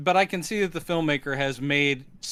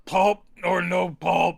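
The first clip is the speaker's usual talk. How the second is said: slow, measured servant voice; henchman taking orders. Acted. Bruta asking about orange juice, slow henchman voice. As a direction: ask it like a plodding henchman.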